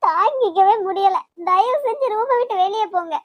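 yelling at him to get out; anguished shout. Acted. She orders him out.

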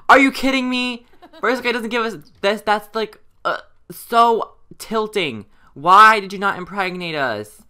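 high, loud frustrated rant; exasperated yelling. Real, not acted. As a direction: shout your disbelief at the game. Failed pregnancy test result.

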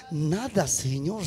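low, soft deflation; hushed anticlimax. Acted. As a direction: drop right down, quiet and low. Job stays silent despite the losses. (en voz baja y grave)

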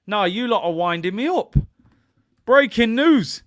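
high, loud shock at being wound up; loud disbelief. Real, not acted. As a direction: protest it, stunned and loud. Stunned by chat's correction.

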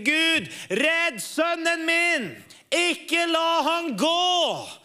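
high, slow pleading cry to God; mother begging God for her son, high slow cry; retold crying out. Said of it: "hun begynner å rope til Gud".

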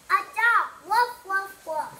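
a loud, happy doggy woof; playful dog barking. Pretend. Kid answering the riddle by barking like a dog, loudly. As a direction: bark woof out loud like a puppy.

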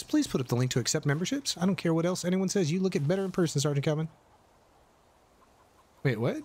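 usual speaking voice

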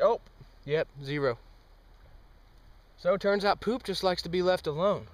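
normal talking voice

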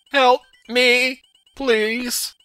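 high mock plea; pitiful high-pitched cry for rescue, hammed up; Luigi impression. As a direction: beg for help in a put-on high voice. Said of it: "and Luigi's like".

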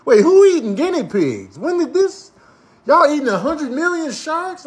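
ordinary talk, nothing marked

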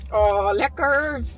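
slow, drawn-out oh of delight; savouring. Real, not acted. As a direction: relish the first bite. Tasting mango on camera.

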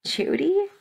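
high, rising disbelief; astonished question. A narrator's reading. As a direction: ask it in sheer surprise. Stunned at rescuer's sudden appearance.

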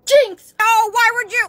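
loud, high yelp into dismay; panicked shout. Acted. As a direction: blurt it out in alarm. Shouts jinx, then dismay.

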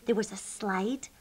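usual speaking voice

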